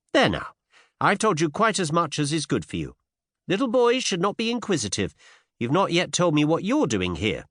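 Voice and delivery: put-on high, playfully scolding young woman; pert Cinderella. A narrator's reading. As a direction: chide him in a high voice.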